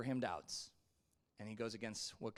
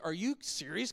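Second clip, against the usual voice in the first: loud, higher-pitched mock disbelief; incredulous; 5 semitones higher, 6 dB louder than usual. Abraham answering God's promise of a child, incredulous. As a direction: act out a scoffing, incredulous reaction. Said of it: "I bet he laughs".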